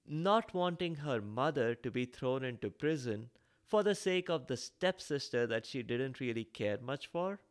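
usual speaking voice

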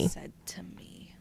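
whispered under her breath. Real, not acted. Guest whispering the question to herself while thinking. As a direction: mutter the question to yourself in a whisper.

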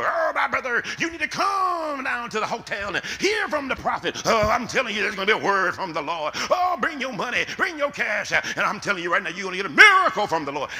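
loud, high, hammed-up revival shouting; mocking a false prophet. Preacher mocking a false prophet luring people with money, loud hammed-up shouting. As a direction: play the slick prophet hyping the crowd for cash.

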